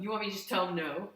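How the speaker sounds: loud, put-on voice of a dismissive employee; rude pizza guy. Pizza guy asking his manager to refuse, rude and loud. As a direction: play the obnoxious worker checking with the boss. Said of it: "it's quite an impression of this fella".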